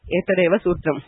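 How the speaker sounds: ordinary delivery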